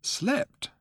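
high, soft disbelieving question; can't believe what he heard; incredulous. A narrator's reading. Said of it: "said Arthur incredulously".

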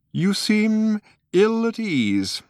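put-on old man, high and slow, politely concerned; kindly old man voice, slow and concerned. A narrator's reading. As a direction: gently ask after his comfort. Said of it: "with polite concern".

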